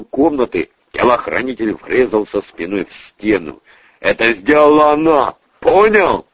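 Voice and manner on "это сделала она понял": angry insistence. Acted. Boss drives accusation home.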